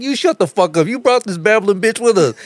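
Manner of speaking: high-pitched, fired-back mock outrage; retold angry retort. Driver turns on friend who brought her, high retold anger. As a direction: hurl it back as in the old argument.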